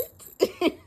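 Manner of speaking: loud, high squeal of a laugh; shrieking laugh at the story; high burst of laughter. Real, not acted.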